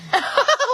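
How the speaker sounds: high, sudden laugh; burst of laughter. Real, not acted. Hearing about the missed visitor, high burst of laughter.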